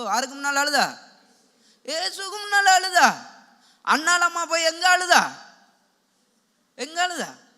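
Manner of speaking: forceful, projected sermon voice; full-force preaching. Real, not acted. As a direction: drive each point and question out to the congregation. (உரத்த, அழுத்தமான பிரசங்கம்)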